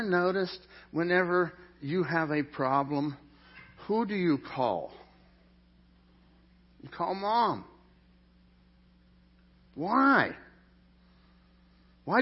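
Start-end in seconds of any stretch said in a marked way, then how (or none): none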